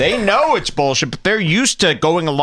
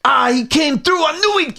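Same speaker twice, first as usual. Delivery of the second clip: high-pitched, cheering mock relief; mock excited relief; 11 semitones higher than usual. Commentator imitating a relieved co-host, mock excited. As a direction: act thrilled that he pulled it off. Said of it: "he's more excited that it sounds like he came through".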